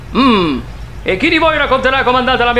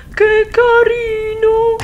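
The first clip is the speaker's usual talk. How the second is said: high, slow cooing imitation; mock cooing; 12 semitones higher, much slower than usual. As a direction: coo like doting relatives. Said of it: "tutti diranno". (imitazione sdolcinata e acuta)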